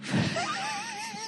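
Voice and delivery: a quick squeal of laughter; high laugh. Real, not acted. Comedian laughing at his own strip-mall joke, high laugh. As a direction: let a laugh break out.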